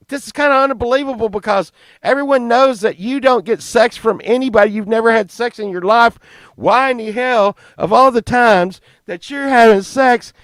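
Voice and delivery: high, loud disbelieving rant; a mock outraged rant; incredulous ranting. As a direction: rant at your friend in mock horror. Friends berating Steve.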